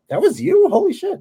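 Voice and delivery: very high retold gasp of surprise; shocked disbelief. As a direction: squeak it out in disbelief. Coworkers learn he drew it.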